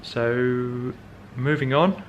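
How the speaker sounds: usual speaking voice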